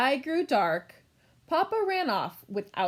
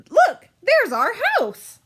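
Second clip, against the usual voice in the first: high, loud, slow delighted call; thrilled to spot home; excited discovery; 10 semitones higher, 9 dB louder than usual. Acted. Kids spot their house.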